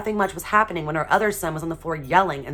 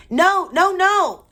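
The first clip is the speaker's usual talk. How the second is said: put-on high, loud toddler's shriek of no; little boy hollers his refusal at the top of his lungs; hollering toddler; 10 dB louder, much slower than usual. A narrator's reading. Toddler refusing new shoes, hollering a shrill no. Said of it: "he hollered".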